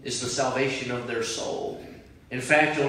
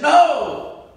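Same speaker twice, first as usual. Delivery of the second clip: high loud shouted no; cried back; 13 semitones higher, 11 dB louder than usual. Retold or imitated. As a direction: fling it back across the water. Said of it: "I cried back".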